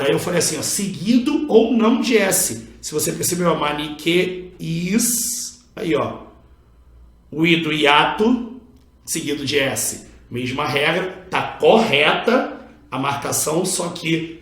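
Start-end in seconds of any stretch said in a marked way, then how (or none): none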